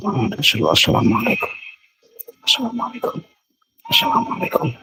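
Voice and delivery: soft, half-whispered salaam; hushed greeting. Real, not acted. Greets an unseen presence in the dark, hushed. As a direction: call softly into the unknown.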